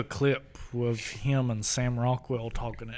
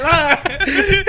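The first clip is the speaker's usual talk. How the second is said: German no; 10 dB louder than usual. Retold or imitated. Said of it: "he said".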